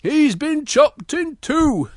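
high, drawn-out horrified cry; Big Bear. Acted. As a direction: wail it slowly in mock horror. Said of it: "And Big Bear goes".

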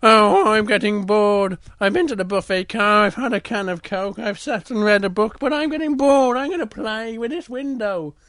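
high, loud bored little bear. Acted. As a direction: complain of boredom in a high cub voice. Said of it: "and Little Bear goes".

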